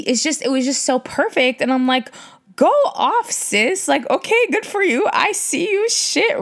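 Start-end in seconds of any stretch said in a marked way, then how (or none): 2.6-6.4 high-pitched sassy cheering on; playful hyping. Retold or imitated. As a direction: hype her up in a high, teasing voice. Mock-hyping her mom.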